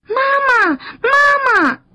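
put-on very high, childlike shout; loud calling. A narrator's reading. Tadpoles rushing to the crab, high loud calling. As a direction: cry out for mother at the top of a little voice. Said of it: "就迎上去大声叫".

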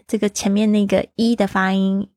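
ordinary talk, nothing marked

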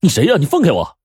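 shouting. A narrator's reading. Boy struggling, shouts at stranger holding him. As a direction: yell at him to let go. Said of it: "大声嚷道".

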